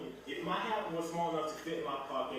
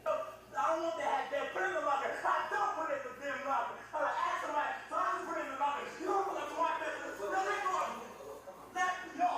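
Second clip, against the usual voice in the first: raised, agitated shouting at the teacher; angry yelling; 11 semitones higher than usual. Real, not acted. Student yelling at the teacher as he is held back, angry. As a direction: yell it out, at the end of your rope.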